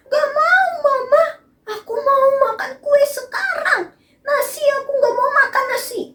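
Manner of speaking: put-on very high, whiny crying kid; child tantrum. Angry child refusing rice, very high whiny crying. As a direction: throw a tearful little tantrum, fast at the end. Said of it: "dia malah marah-marah".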